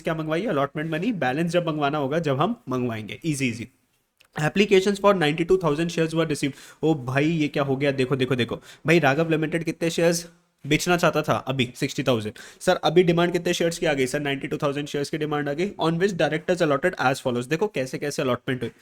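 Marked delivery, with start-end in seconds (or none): 4.4-6.5 reading the question aloud word by word; unhurried dictation pace; slow question reading. Shown as a demonstration. Teacher reads question aloud slowly. 15.8-17.5 reading the question aloud word by word; unhurried dictation pace; slow question reading. Shown as a demonstration. Teacher reads question aloud slowly.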